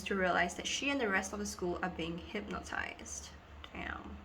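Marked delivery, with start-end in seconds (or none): 3.7-4.2 whispered curse. Real, not acted. Mutters after a slip.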